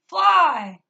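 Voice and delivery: high, very loud shout of one word; imitated yell. Woman going by yells at her, imitated yell. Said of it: "she yells as she's going by".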